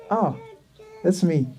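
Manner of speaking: normal talking voice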